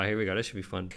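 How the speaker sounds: normal talking voice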